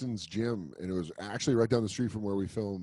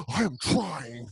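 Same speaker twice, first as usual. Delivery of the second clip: slow, drawn-out self-defence; mock frustrated protest; 3 semitones higher, much slower than usual. Actor imitates himself getting frustrated with the trainer. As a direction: plead with him slowly. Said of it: "where I'm like".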